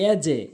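"এজে" with an echo after the j; bouncing jim. Shown as a demonstration. Teacher demonstrating qalqala, "এজে" with bounced jim. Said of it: "এজে হবে".

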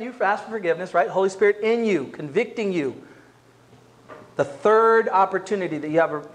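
ordinary delivery

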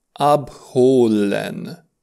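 abholen stretched very slowly, syllable by syllable; very slow model word. Shown as a demonstration. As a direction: draw out ab-ho-len for learners. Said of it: "se pronunță".

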